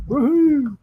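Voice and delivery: high bright cheer; excited whoop. Real, not acted. Cheering her hillbilly persona with a whoop. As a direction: let out a joyful whoop.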